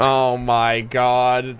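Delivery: slow, awed gush. Real, not acted. Awed by the gift idea.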